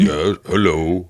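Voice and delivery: slow, booming giant greeting; slow, weighty "hello" like a big giant. Pretend. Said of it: "You don't just do your normal voice, your normal giant voice".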